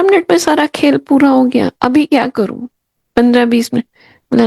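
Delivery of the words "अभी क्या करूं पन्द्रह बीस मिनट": ordinary talk, nothing marked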